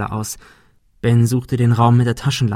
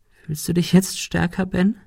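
high, wounded question; sting him with a high voice; reproachful Monika; 7 semitones higher than usual. A narrator's reading. Monika challenges Ben after the blow, high reproach.

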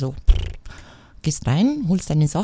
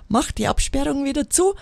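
normal talking voice

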